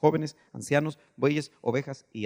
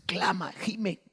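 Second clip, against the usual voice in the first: softer, higher, breath-held delivery; hushed moaning plea; 4 semitones higher, 4 dB quieter, much slower than usual.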